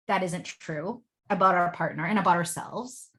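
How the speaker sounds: normal talking voice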